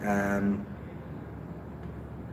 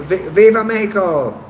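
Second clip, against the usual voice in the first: excited; 7 semitones higher, 5 dB louder than usual. Real, not acted. Cheering a chat greeting.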